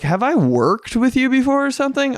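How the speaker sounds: high-pitched mock confusion; puzzled wonder. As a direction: wonder aloud in a high voice whether you know her. Puzzled inner thought, very high.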